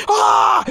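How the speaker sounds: high-pitched mock crowd scream; imitated fan scream. Grown fans see the star outside, screaming. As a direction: shriek like a fan spotting an idol. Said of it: "They start screaming".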